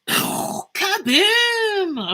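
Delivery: loud high playful kaboom; cannon boom. Pretend. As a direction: shout a cartoon explosion. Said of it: "make explosion noises".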